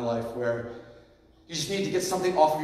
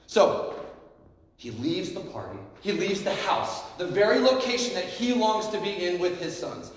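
ordinary talk, nothing marked

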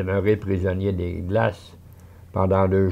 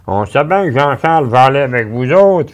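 emphatic mother; 6 semitones higher, 10 dB louder than usual. Acted. Mother answers firmly.

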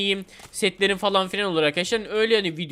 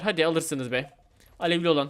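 ordinary talk

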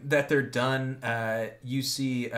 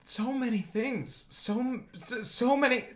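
high, softer frightened stammer; frightened captive; 9 semitones higher, 3 dB quieter than usual. Acted. Captive answers his killers about fear, frightened.

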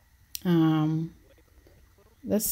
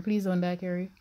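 ordinary talk

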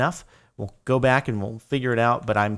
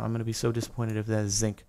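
ordinary talk

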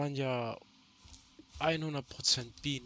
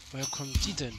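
ordinary delivery

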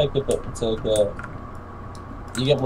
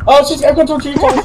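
panicked; 13 semitones higher, 8 dB louder than usual. Real, not acted. Panicked gameplay.

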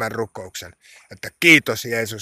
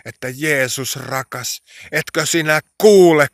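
loud, pleading prayer cry; hätäinen huuto; 4 semitones higher, 6 dB louder than usual. Retold or imitated. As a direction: plead urgently, raising the voice on the key word. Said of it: "sinun nimeäsi huutaa". (hätäinen, kovaääninen rukoushuuto)

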